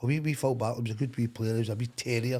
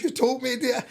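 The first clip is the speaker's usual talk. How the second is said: mock indignant player; 13 semitones higher, 4 dB louder than usual. Player's retold defence.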